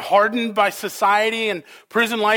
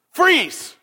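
sharp high shout, much higher and louder; parent's shout; 9 semitones higher, 5 dB louder than usual. Retold or imitated. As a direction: yell it to stop a child dead. Parent stopping child at street.

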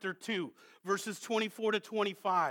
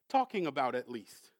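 ordinary talk

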